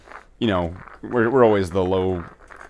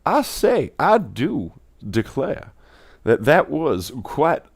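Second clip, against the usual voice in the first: higher, slow Southern drawl; cartoon Southern accent; 6 semitones higher, slower than usual. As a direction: savor each word, Southern gent. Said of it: "southern impersonation of a cartoon".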